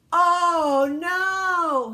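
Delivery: high, loud, drawn-out "oh no"; big storybook dismay; dismayed cry. A narrator's reading. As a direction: call it out in playful alarm. Voicing alarm in the story.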